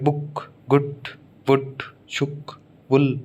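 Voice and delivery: बुक, गुड, वुड, शुक, वुल with a short "u"; short u vowel. Shown as a demonstration. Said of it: "ऊ जो है".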